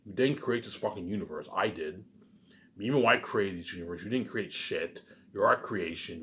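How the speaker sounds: normal talking voice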